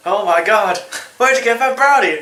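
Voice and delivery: high-pitched excited exclamation, imitated; mock starstruck excitement. Fan meeting a Beatle and spotting a brownie, mock excitement.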